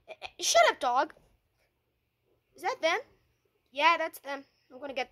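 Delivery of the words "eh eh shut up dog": yell at dog. Pretend. Figure yelling at barking dog to shut up, sharp shout. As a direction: snap at the noisy dog.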